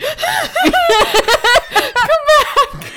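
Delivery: pleading with a phone while shaking it, high and laughing; mock desperate plea. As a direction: beg the lost notes to come back, squeaking through laughter. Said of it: "the desperate shake".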